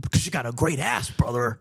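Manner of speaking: light wrestler promo voice; a hint of Hulkster bravado; Hulk Hogan impression. Co-host answers as the Hulkster, lightly voiced wrestler. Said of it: "Who's in it, Hulkster?".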